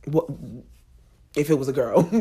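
ordinary talk, nothing marked

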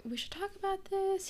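high, soft, whispery imitation; hushed mock quote. Quoting his lack of warning to her, high whispery mock voice. Voicing the boyfriend's line.

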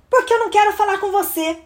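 fast, high irritated reply; impatient sibling rattling it off; annoyed big sister. Acted. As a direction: brush off the pest quickly. Annoyed sister answers pest.

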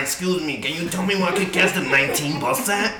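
imitating Bronx locals. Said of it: "I just started talking like them".

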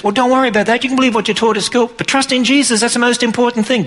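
high, sustained mock-reassuring voice; mimicked placating church. Church answering doubting young people, mimicked placating reassurance. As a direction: hold it up high, imitating someone brushing off a worry.

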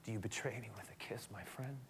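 hushed, wounded whisper; Jesus whispering to Judas at the betrayal. Retold or imitated. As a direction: breathe it out, betrayed by a friend. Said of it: "to have him kiss Jesus".

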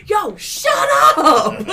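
high, drawn-out mock scream; imitated screaming. Reveler on the phone to noisy crowd, comic screaming. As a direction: yell over a crowd, stretching "up". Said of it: "starts screaming at everybody".

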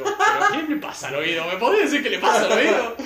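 high, loud exasperated plea. Retold or imitated. Asking the doctor what's wrong, exasperated. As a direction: demand an answer, fed up.

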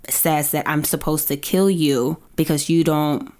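normal talking voice

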